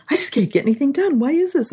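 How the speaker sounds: high, quick fretting to yourself; exasperated self-talk. Retold or imitated. Acting out own exasperated inner voice.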